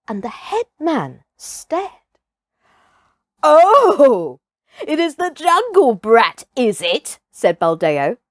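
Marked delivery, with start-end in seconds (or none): 3.4-7.2 loud, higher sneer at the jungle brat; offended hunter scoffing. Acted. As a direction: sneer down at the boy.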